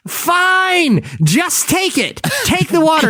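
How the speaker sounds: high exasperated giving in; exasperated panel. Retold or imitated. Panel giving in to the actor, high pitched. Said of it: "they're like".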